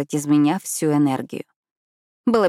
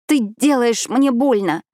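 higher, slow, strangled protest; strained cry; 7 semitones higher, 3 dB louder, slower than usual. A narrator's reading. Phoebe, wrist gripped, protests in strained voice. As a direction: squeeze the words out in pain. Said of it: "— сдавленно сказала она".

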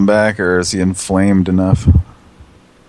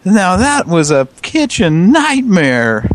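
slow, higher dramatic catchphrase to camera; TV host sign-off; 10 semitones higher, much slower than usual. Retold or imitated. Imitating a TV chef summing up to camera, slow. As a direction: deliver it like a reality show tagline. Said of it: "he looks at the camera and says".

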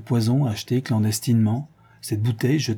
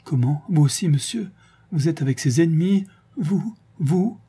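higher, rising moan of dismay; wounded, higher-pitched questions; 6 semitones higher than usual. A narrator's reading. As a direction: moan it in horrified disbelief. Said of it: "elle gémit".

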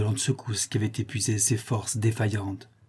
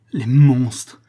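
much louder horrified exclamation; indignant outburst; 9 dB louder than usual. Acted. Woman hears of her lover's maiming, indignant outburst. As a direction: burst out against the wrongdoers.

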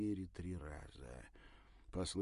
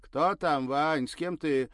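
much higher, loud wife's call; 9 semitones higher, 16 dB louder than usual. Acted. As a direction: call from the back room. Wife calling from inside.

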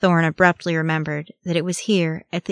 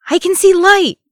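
very high, loud cry of joy; wildly joyful call; 14 semitones higher, 10 dB louder than usual. A narrator's reading. Legolas spots light at the tunnel's end, wildly joyful. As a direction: call out in wild relief. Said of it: "called, a note of wild joy in his voice".

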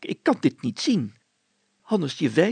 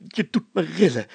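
usual speaking voice

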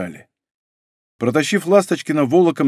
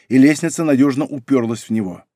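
plain reading voice

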